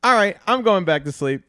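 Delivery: loud, high punchline delivery; high, projected comic voice; acted giving up, projected. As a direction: repeat the bedtime line big, as a cue. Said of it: "so then they say".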